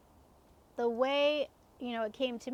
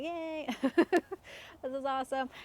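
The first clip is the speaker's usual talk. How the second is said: put-on giddy kid cheer; 3 semitones higher than usual. Kids alone, pizza every night.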